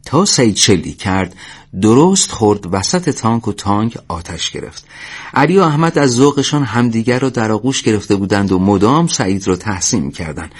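plain reading voice

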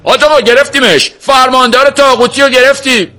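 high, loud yell of news; triumphant young shout. A narrator's reading. Armed young man telling the sheikh of a capture, shouting. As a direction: shout it across the courtyard. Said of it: "جوانتر بود فریاد زد".

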